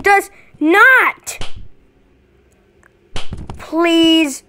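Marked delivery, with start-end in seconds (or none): none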